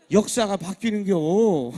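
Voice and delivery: loud, drawn-out Chungcheong ending; Chungcheong dialect. Acted. Preacher boasting of Chungcheong voters, drawn-out Chungcheong dialect. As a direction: stretch the last syllable like Chungcheong folk. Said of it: "충청도 사람 손에 달렸어요".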